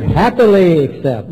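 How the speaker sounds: happily stretched slowly and leaned on; stress on happily. Shown as a demonstration. Speaker explaining the key word to the meeting, happily stressed. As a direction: punch happily and draw it out. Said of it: "I emphasize that word".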